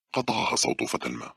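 usual speaking voice

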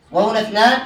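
ordinary delivery

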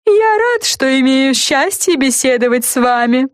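high, grandly pompous lollipop. A narrator's reading. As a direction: speak with lofty ceremony. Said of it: "высокопарно проговорил леденец".

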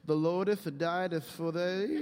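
slow, pompous olde English voice; hammed-up archaic preacher; mock Shakespearean. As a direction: put on a grand King James reading voice. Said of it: "you feel like you're reading Shakespeare".

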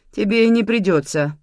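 usual speaking voice

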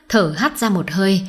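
plain reading voice

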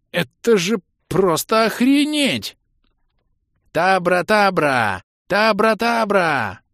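высокий, громкий, растянутый крик; вопить нараспев, растягивая слова; протяжный вопль. A narrator's reading. Голос из-под зада мамонта вопит. (истошно и протяжно голосить)

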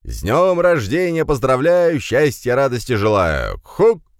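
loud, high, theatrical recital; declaiming. A narrator's reading. Uninvited guest recites birthday wishes at door, declaiming. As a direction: declaim a greeting in a trained voice. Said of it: "начал декламировать чей-то поставленный голос".

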